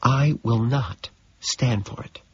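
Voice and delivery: a hushed, slow rebuke; whispered. Acted. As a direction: hiss it out quietly, slowly. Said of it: "she whispers".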